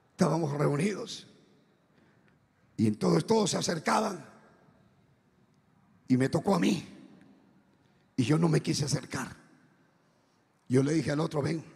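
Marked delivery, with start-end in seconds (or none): none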